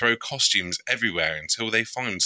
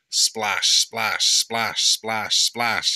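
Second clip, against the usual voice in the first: breathy splash sounds; splashing noises; 3 semitones higher, 3 dB louder, much slower than usual. Acted. Happy clearing the puddle, mock splash sounds. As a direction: spit out each splash like water. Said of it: "says Happy".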